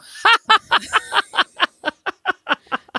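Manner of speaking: high laugh that falls away; high burst of laughter. Real, not acted. Sister laughs at joke.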